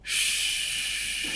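soft, drawn-out "shhh"; sustained quiet shushing; long shush. Shown as a demonstration. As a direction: hush the room with one long hiss. Imitated hushing noise.

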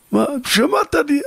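father scoffing at the doctor; outraged retort. Acted. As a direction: fire it back in disbelief.

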